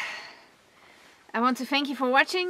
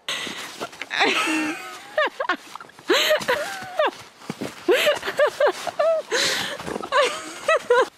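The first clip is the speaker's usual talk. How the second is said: long high giggle, louder; high delighted laugh; 3 dB louder than usual. Real, not acted. Laughing at the animal.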